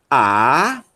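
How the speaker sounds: "A" alone, held long, no aspiration; isolated, deliberate "A"; vowel demo. Shown as a demonstration. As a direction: model a drawn-out unaspirated "A". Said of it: "aí a sílaba tônica".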